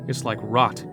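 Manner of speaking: ordinary delivery, nothing marked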